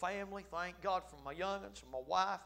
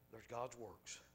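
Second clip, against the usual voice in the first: much softer, hushed and sincere; quiet reverent confession; 6 semitones lower, 11 dB quieter, slower than usual. Acted. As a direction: say it softly, in awe. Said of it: "we ought to say".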